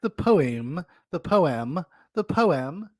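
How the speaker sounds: poem split into two syllables, PO-em; amused mimicry, repeating poem as "po-em"; mocking pronunciation. Mimics her odd pronunciation of poem.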